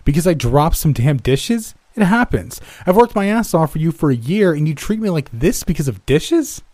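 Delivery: high, loud outraged yell; fired waiter yells back at his boss, high and loud. Acted. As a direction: shout back in disbelief. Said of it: "I yelled back".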